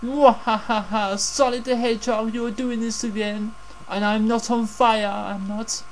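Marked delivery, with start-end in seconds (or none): 0.0-1.2 slow evil cackle; villain laugh. Pretend. Voicing Eggman villain laugh.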